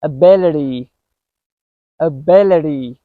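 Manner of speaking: Ability with the T as a quick D; American flap T. Shown as a demonstration. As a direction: tap the T in Ability. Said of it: "try some words using this".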